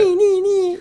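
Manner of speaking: high squeaky ni ni ni; cat voice. Pretend. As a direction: squeak it like a kitten. Playing with cat sound.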